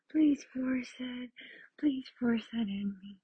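whispering. Acted. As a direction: beg it in a whisper. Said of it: "whispered takes".

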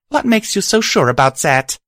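high and loud in anger; angry. Acted. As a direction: challenge her angrily. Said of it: "I said angrily".

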